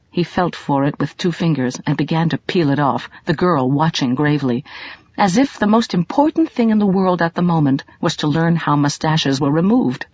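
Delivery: plain reading voice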